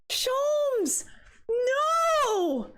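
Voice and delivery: mock grief wail. Real, not acted. Wails the character's name.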